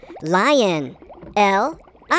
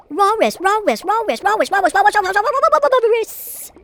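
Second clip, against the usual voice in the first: "Walrus" repeated faster and faster into babble; high, goofy "Walrus" loop ending in a long gibberish flourish; silly rapid repetition; 6 semitones higher than usual. Pretend. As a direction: speed up "Walrus" until it collapses into nonsense. Repeats the word until it garbles.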